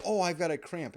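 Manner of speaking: loud, high put-on complaint; hammed-up sudden pain; faked cramp cry. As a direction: cry out as a hasty cover story. Covers up with a fake cramp.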